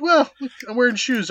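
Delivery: high, loud making of excuses; hammed-up embarrassed exit line; mock awkward escape.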